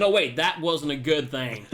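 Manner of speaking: normal talking voice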